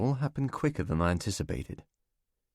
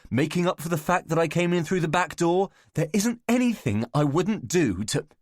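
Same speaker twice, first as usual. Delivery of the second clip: rising, louder, higher indignant rant; angry outburst; 9 semitones higher, 3 dB louder than usual. Acted. Alec vents to his friends about unfair bosses, angry and loud. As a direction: build the grievance until it bursts.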